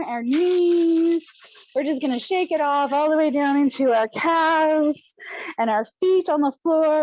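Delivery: walk them through the body calmly; guided relaxation. Acted. Leader guides the group through a body shake-off, calm.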